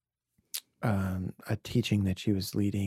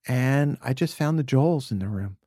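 ordinary talk, nothing marked

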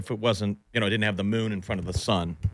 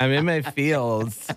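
sexy cat lady; 3 semitones higher, slower than usual. Pretend. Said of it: "You sound like a sexy cat lady".

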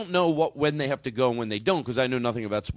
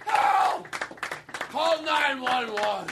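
mock cry for help; 11 semitones higher, much slower than usual. Mock collapse after the tirade.

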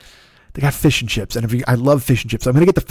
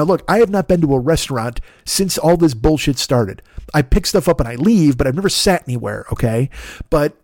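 ordinary talk, nothing marked

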